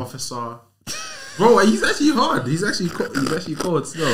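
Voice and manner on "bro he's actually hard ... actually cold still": high and loud, buzzing with excitement; excited shout of approval; hyped-up praise. Real, not acted. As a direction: rave about it like you can't believe it. Hyping up a rapper's track.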